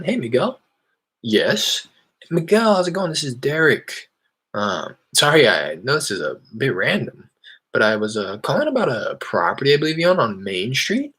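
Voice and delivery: each phrase ends rising like a question; upward inflection. Shown as a demonstration. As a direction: pitch the phone opener with questioning lifts. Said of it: "with that upward inflection to make it sound like a question".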